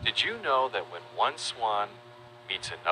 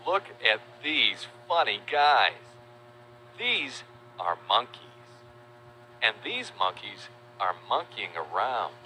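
put-on reading to a toddler. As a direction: coo it to a toddler. Talks to toddler viewers.

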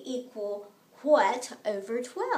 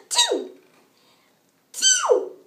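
much higher and louder squealed answer; excited squeal; 8 dB louder than usual. Acted. Baby shrieking the answer to the kids. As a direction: squeal it like a thrilled discovery.

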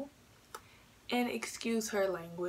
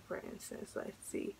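whispered aside; 12 dB quieter than usual. Real, not acted. Trailing aside to self.

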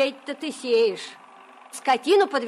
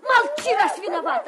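high, loud furious scolding; angry shout; 9 semitones higher, 4 dB louder than usual. Acted. Scolding a crying girl.

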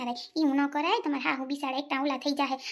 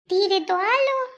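calling out; 4 semitones higher, 6 dB louder, slower than usual. Acted. Wife calling to husband.

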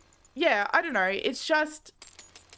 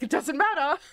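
raised voice breaking into a laugh; forced optimism; 4 semitones higher than usual. Retold or imitated. Drenched by a puddle, still insisting, laughingly upbeat. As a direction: insist through a rising giggle.

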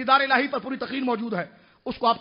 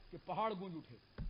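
very soft, low-pitched line; quiet; 4 semitones lower, 17 dB quieter than usual. Real, not acted. Preacher telling of the echoing mountains, hushed and low. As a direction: let it sink low and soft. First take, dropped low before repeat.

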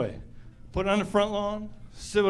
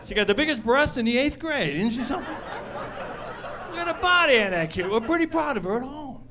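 mock proud parent; 4 semitones higher than usual. Parody bragging parent.

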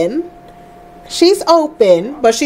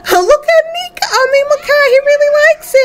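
much higher, laughing and gushing; laughing delight; 14 semitones higher than usual. Real, not acted. Delighted at kids bonding.

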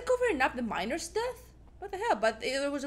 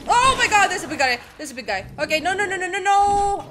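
high, loud panic; frantic loud no's; panicked; 6 semitones higher, 10 dB louder than usual. Real, not acted. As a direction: shriek in alarm at the monster. Big enemy appears.

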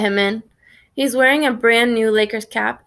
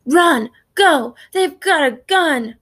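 high, loud panicked warning; raised-voice cry to flee; urgent shout; 5 semitones higher, 3 dB louder, slower than usual. A narrator's reading. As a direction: shout short bursts of alarm. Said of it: "Bones shouts".

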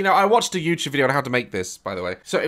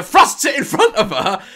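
ordinary delivery

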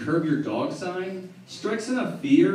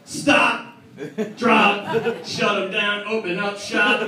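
[name] shout; 5 semitones higher, slower than usual. Retold or imitated. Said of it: "D M X turns around".

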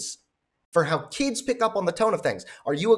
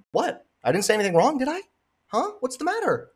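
higher-pitched feigned bewilderment; mock innocent protest; 8 semitones higher than usual. Partner defending careless words, mock innocent. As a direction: act puzzled that anyone objects to your tone. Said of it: "Doesn't it matter how I'm saying it?".

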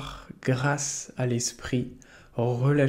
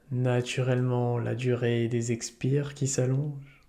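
calm yoga nidra guide, unhurried with long pauses; soothing meditation voice; guided meditation. Acted. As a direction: lead listeners gently into relaxation, leaving space between phrases.

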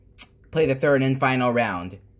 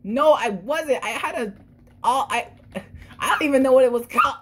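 high whiny protest; 9 semitones higher, 3 dB louder than usual. Acted. Arguing back in character.

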